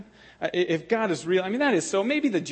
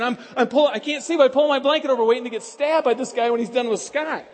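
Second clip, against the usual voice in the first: normal talking voice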